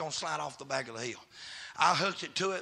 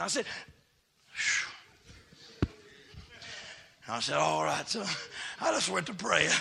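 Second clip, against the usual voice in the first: shouted, chanted preaching with whoops; full-voiced holler of a Southern preacher; preaching at full force; 9 semitones higher, 17 dB louder than usual. Real, not acted. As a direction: preach it loud, rising into whoops.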